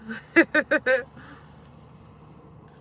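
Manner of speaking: a long, warm laugh; real laugh. Real, not acted. Laughing as she thanks a loyal viewer for stopping in. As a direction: laugh along with your viewer.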